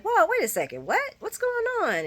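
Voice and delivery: high, loud double take; startled whoa. Retold or imitated. Ned overhearing the deception plan: high startled surprise. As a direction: jump in as if caught off guard.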